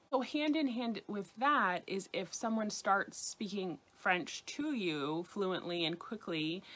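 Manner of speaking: ordinary talk, nothing marked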